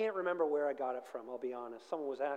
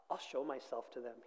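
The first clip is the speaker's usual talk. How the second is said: low, quieter voice for a thought; inner thought; 5 semitones lower, 7 dB quieter than usual. A narrator's reading. Donkey thinking to himself as he spots people, quiet and low. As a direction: read it as a thought, pitched low and softly. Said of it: "he thought".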